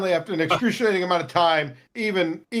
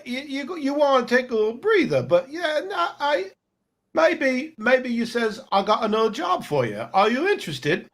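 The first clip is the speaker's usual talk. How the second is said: lightly done bad British accent; a hint of British, higher than usual; hammed-up British merchant, higher pitch; 5 semitones higher than usual. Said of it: "the really bad British one".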